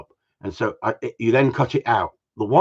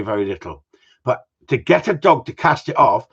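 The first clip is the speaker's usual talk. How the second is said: ordinary delivery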